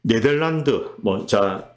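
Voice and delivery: normal talking voice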